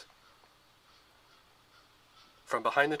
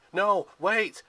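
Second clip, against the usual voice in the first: loud, high panicked cry; desperate scream; 8 semitones higher, 7 dB louder than usual. A narrator's reading. Percy trying to stop the arrows, loud desperate cry. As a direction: try to stop them in time. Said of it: "Percy screamed".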